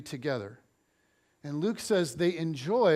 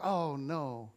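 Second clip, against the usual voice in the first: mock dread; 3 semitones lower, 6 dB quieter than usual. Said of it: "And I'm like".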